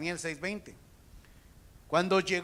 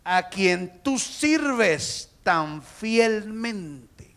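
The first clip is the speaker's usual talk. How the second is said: loud, very slow proclamation; slow shouted question; crying out; 3 semitones higher, 8 dB louder, much slower than usual. Acted. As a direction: stretch each word at full voice. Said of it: "Y grita y dice".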